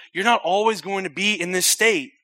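usual speaking voice

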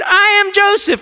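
high, loud cry of self-revelation; impassioned preacher's shout; yelled revelation. Acted. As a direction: burst out with who you are. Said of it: "he yells out".

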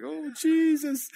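mock tearful swoon.

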